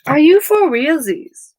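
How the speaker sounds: a real "are you serious" moment; exasperated surprise. Real, not acted. Reader drops her cards and cries out in surprise.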